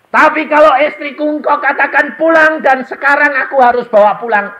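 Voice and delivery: high, loud pleading to God; loud anguished prayer; crying out in prayer. Retold or imitated. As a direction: call out desperately to the Lord. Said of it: "Saya berdoa".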